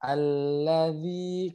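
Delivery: slow alladzi, dh between the teeth; clear dzal. Shown as a demonstration. Teacher showing the dzal sound. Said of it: "ujung lidah bertemu".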